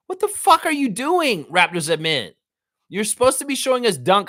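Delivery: high, loud disbelieving yelp; exasperated outburst. Real, not acted. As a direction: squeal it in outrage at the admin. Ranting at Raptors admin.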